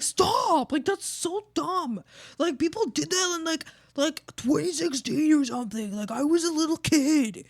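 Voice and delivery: mocking teen coworker. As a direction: sneer that dabbing is old. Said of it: "they'll be like".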